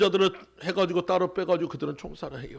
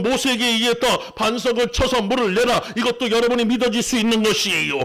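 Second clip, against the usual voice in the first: raised, louder preacher's delivery; forceful preaching; 4 semitones higher, 5 dB louder than usual. Real, not acted. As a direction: drive it home to the congregation.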